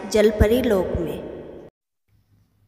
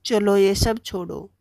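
lightly voiced young prince; 3 semitones lower than usual. Rescued prince speaks to mermaid.

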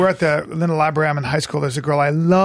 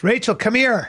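calling out; 8 semitones higher than usual. Retold or imitated. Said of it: "he goes".

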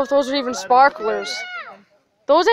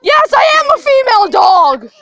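loud sharp voice; 5 semitones higher, 7 dB louder than usual. Real, not acted. Defiant loud joke reply.

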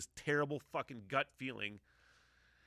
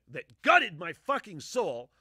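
emphatic; 6 semitones higher, 12 dB louder, slower than usual. Real, not acted. Emphatic about emotional scene.

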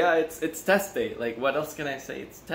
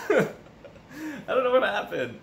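laughing; 12 semitones higher, much slower than usual. Real, not acted. Laughing at bad sleep.

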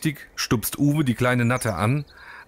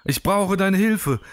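slow, higher, a hint of being out of breath; mock-gasp the plea, worn out from flying; 6 semitones higher, slower than usual. Günther the exhausted bat begging Uwe, lightly gasping. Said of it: "japst Günther".

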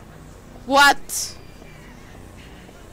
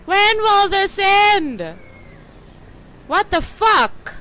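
higher, louder, slow desperate cry; being chased; 5 semitones higher, 4 dB louder than usual. Real, not acted. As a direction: beg for it to end. Chased, panicking in game.

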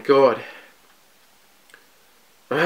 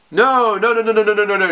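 higher, rapid string of no's; can't accept the chapter ending there; real fan outrage at the cliffhanger; dismayed protest; 6 semitones higher than usual. Real, not acted.

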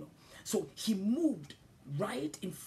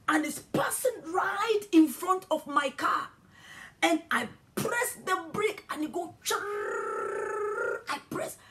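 high and loud, building shock; alarmed storytelling; 10 semitones higher, 7 dB louder than usual. Retold or imitated. Preacher reliving the near miss, high and loud. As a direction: tell it as if the man just stepped out. Sudden man before car.

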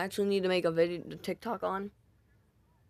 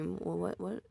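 ordinary talk

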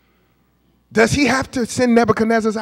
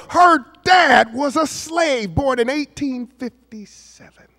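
high, emphatic preacher's delivery; raised sermon voice, stretching the key words; impassioned preaching; 7 semitones higher than usual. Acted. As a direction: preach it high, hammering home each phrase. Preaches with rising emotion.

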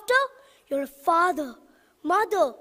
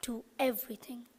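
whisper; 9 semitones lower, 14 dB quieter than usual. Shown as a demonstration. Closing the motivational speech to the audience, whispered. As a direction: whisper it, breathy and hushed.

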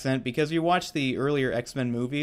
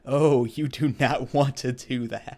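slow, mock-menacing warning through a laugh; a lightly voiced Deadpool, stretched out and laughing; Wade's comeback; slower than usual. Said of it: "Wade's like".

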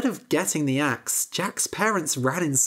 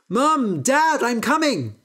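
higher, louder call down; shouting to parents; 7 semitones higher, 4 dB louder than usual. Acted. Jack calls down to his parents, higher shout. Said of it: "he shouted".

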